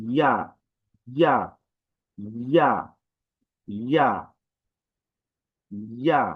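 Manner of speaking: ইয়া said short each time; repeated letter drill. Shown as a demonstration. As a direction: keep repeating ইয়া for practice. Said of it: "এটা উচ্চারণ হয়".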